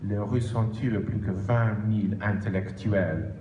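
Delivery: French with a Polish accent; careful French with a Polish accent. Real, not acted. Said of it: "mon français, malheureusement, n'est pas superbe". (accent polonais en français)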